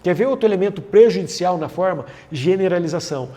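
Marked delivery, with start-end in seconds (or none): none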